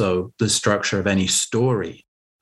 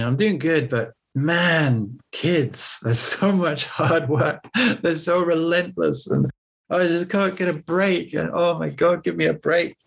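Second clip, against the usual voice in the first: higher whiny complaining; 7 semitones higher than usual. Retold or imitated. Retelling complaining about kids.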